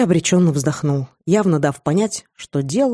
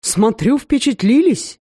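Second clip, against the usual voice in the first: higher, slow smug smirk; 6 semitones higher, slower than usual. A narrator's reading. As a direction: say it with a knowing smirk. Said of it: "ухмыльнулся Эрик".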